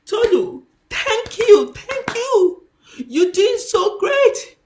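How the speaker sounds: joyful voice of God. Retold or imitated. Pastor retelling God praising her, laughing and tearful. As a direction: voice God's delighted praise, near tears. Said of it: "when God first of all told me".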